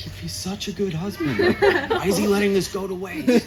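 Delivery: frustrated wife. Retold or imitated. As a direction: throw up your hands at the waste. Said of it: "She's like".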